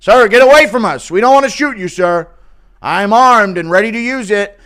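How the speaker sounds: high, loud officer warning; mock police shout. Police shouting at an armed man. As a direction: order him back like a cop.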